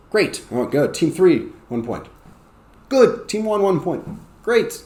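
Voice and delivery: high, quick classroom calls; game-running teacher. Shown as a demonstration. Teacher awards points to teams, high and lively. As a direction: fire out words and hand out points.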